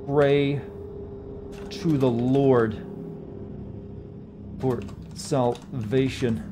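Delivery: slow, stretched words with long gaps; halting, drawn-out dictation; dictating while typing. Real, not acted. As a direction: speak each phrase as you type.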